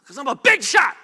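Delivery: loud, high, puffed-up Naaman; proud big shot. Acted. Preacher playing proud Naaman, shouting his own importance. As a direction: boom it out like a pompous general.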